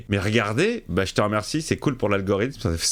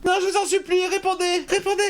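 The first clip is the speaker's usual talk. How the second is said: very high hammed-up begging; mock desperate pleading. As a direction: plead shrilly like a horror victim. Begging the radio to answer.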